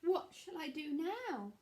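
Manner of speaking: put-on high, slow girlish fretting; Red asks herself what to do, worried. A narrator's reading. Red worrying over the cake.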